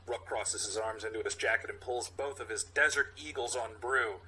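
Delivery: ordinary talk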